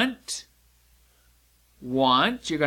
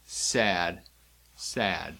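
trailing, softer sad word; 8 semitones lower, 5 dB quieter than usual. Shown as a demonstration. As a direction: let sad sink down low.

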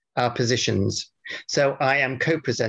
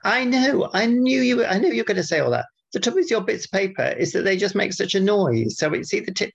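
exasperated; 8 semitones higher than usual. Real, not acted. Bickering, knew you'd say.

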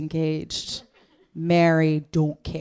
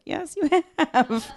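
mock phone voice; 10 semitones higher, slower than usual. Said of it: "your phone was like".

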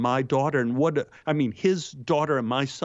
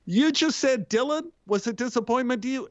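mock surprise; 11 semitones higher than usual. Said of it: "And I go".

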